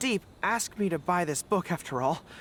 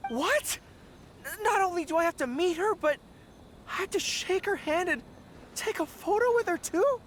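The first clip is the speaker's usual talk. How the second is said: rising panic, pitched way up; shocked disbelief; 9 semitones higher than usual. Acted. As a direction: voice climbing in alarm. Shocked at meeting Lady Yae.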